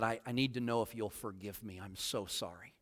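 very soft, low, contrite plea; retold weeping apology. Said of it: "I'm weeping at this time".

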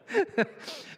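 short breathy high chuckle; brief wheezy laugh; real laugh. Real, not acted. Laughs after the joke.

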